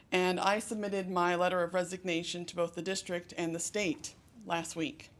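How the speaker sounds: usual speaking voice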